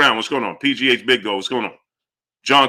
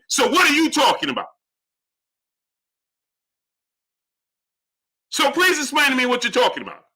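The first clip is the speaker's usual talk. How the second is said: high, indignant demand; exasperated challenge; 5 semitones higher than usual. Real, not acted. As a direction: confront the viewer, fed up. Demands explanation from viewer.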